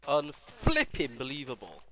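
exasperated shout. Real, not acted. Repeats louder, slower.

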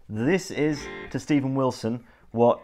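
ordinary delivery

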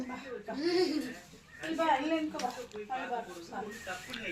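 hushed, slow, soothing call; soft coaxing. Real, not acted. Parent calls upset child over to stand here, hushed coaxing.

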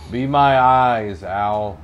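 slow booming command; dark lord. Pretend. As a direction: command slowly like a dark overlord. Commands owl in villain voice.

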